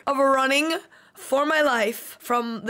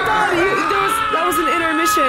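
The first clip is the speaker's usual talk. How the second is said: high, loud panicked yelling; frantic panic; 5 semitones higher, 3 dB louder than usual. Real, not acted. Player yelling at David while running away, panicked. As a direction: yell at the chaser in terror.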